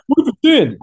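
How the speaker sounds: high, loud mock excitement; excited friends. Excited friends asking about the shot, high excited yell. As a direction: squeal it like over-excited buddies.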